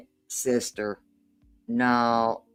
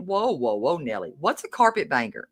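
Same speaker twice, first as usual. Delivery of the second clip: usual speaking voice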